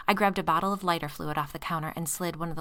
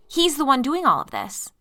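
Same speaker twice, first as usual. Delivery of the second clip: screeching; 9 semitones higher, 10 dB louder than usual. Acted. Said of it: "I screeched".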